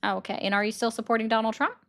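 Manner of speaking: ordinary delivery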